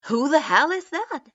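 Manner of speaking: loud, high startled yell. A narrator's reading. Deidara spotting the kid on Itachi, yelling. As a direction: shout it across the room. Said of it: "Deidara yelled".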